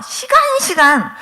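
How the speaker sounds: fighting back tears mid-sentence; choked up. Real, not acted. Preacher choking up recalling being looked down on. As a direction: voice catching high with tears, slowly. Testimony of past humiliation.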